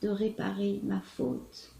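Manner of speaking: measured, expressive literary reading with swells and pauses; dramatic love-letter reading. Acted. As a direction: read it as an ardent lover's confession. Reads a love letter aloud.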